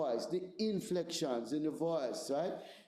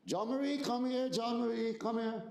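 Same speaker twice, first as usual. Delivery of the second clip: put-on high, sing-song calling a child; light, friendly call; 6 semitones higher than usual. Shown as a demonstration. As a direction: call her over sweetly, no trouble. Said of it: "the tone of my voice".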